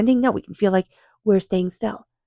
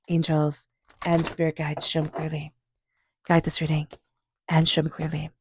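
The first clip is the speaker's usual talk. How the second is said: quiet invocation; 2 semitones lower, 5 dB quieter than usual. Real, not acted. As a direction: murmur the call to the angels, unhurried. Invoking spirit guides before reading.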